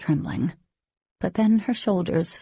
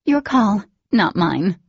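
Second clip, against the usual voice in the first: high, loud defiant retort; defiant snap; 4 semitones higher, 7 dB louder, slower than usual. Acted. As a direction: throw it back at him. Dee defies her brother.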